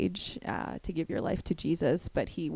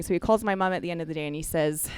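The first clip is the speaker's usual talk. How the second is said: usual speaking voice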